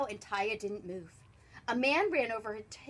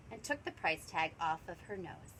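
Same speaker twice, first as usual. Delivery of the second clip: usual speaking voice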